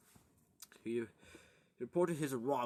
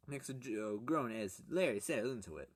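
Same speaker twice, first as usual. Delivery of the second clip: plain reading voice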